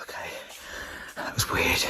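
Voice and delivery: frightened whisper, almost no voice. Real, not acted. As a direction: breathe the words out in fear. Alone in a dark building.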